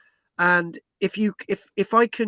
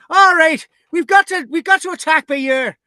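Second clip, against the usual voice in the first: put-on much higher, louder Irish accent; 8 semitones higher, 6 dB louder than usual. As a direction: play a rowdy Irish warrior planning. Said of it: "They're thinking".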